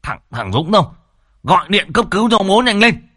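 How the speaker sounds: yelling orders. A narrator's reading. Father yelling at his son to call an ambulance, urgent shout. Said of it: "lão Sửu vẫn quát lớn".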